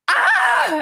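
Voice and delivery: sudden high, loud "ah"; short high yelp of exasperation; frustrated cry. Real, not acted. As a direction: burst out as you give up. Gives up on the impression.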